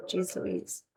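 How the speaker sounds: whispered under breath. Real, not acted. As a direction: breathe it out unvoiced. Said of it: "my nose is stuffy".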